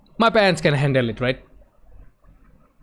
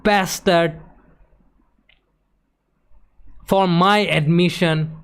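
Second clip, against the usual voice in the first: retold tearful yell; 4 semitones higher, slower than usual. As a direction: hurl the questions at them through tears. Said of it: "And I just yell at them".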